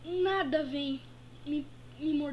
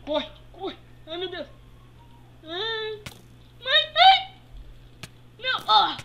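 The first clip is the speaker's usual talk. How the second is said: loud panicked yells while fleeing; being chased; 8 semitones higher, 8 dB louder than usual. Acted. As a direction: run and scream in panic. Running from danger.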